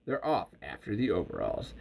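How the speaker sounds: usual speaking voice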